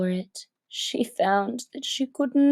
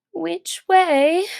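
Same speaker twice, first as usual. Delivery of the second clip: anxious; 7 semitones higher, 7 dB louder than usual. Acted. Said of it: "said anxiously to herself".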